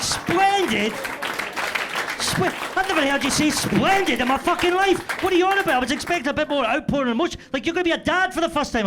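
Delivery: high, loud hammed-up exasperated wife; incredulous wife. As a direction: shriek her disbelief back at him. Wife voiced, incredulous and shouting.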